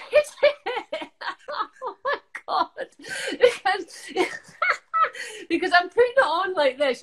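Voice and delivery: high, helpless giggling; cracking up at your own mistake; laughing. Real, not acted. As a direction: talk through real laughter. Realises she wore bronzer as foundation.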